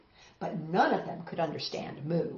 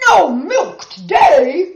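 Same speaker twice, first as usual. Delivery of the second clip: loud, slow, dismayed cry; cried out; 9 semitones higher, 16 dB louder, slower than usual. Acted. Farmer reading the strike note, loud slow cry. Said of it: "cried Farmer Brown".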